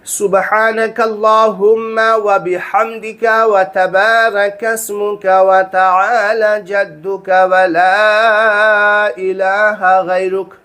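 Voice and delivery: slow, careful chanted recitation of the sana; slow devotional Arabic recital; slow prayer recitation. Shown as a demonstration. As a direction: recite it reverently, word by word. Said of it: "कौन सी?".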